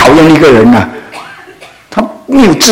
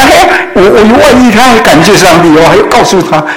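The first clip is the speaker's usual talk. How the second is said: astonished; 8 semitones higher than usual. Retold or imitated. Husband's astonished thoughts, voiced by preacher.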